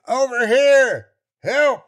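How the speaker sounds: high, loud, slow cry for help; desperate shout. A narrator's reading. Hanging man shouting to the search party, desperate. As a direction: yell to be found. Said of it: "I screamed into the air".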